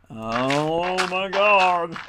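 drawn-out exclamation. Real, not acted. Gamer groaning at the game, loud and drawn out. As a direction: stretch it out in exasperation. Exasperated outburst while mining.

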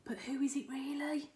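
fast hushed question; curious whisper. Real, not acted. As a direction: whisper it with intrigue. Reader aside, hushed.

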